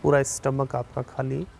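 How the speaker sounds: slow, measured relaxation guidance with long pauses; unhurried yoga nidra instructor, slightly softened; guided meditation. Real, not acted. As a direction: guide them calmly through breathing, drawing out counts and cues. Leads a yoga nidra session.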